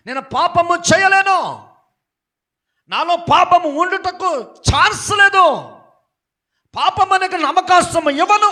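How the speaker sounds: high, loud, slow shout of refusal. Acted. Joseph shouting at Potiphar's wife, refusing sin. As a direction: cry out the refusal.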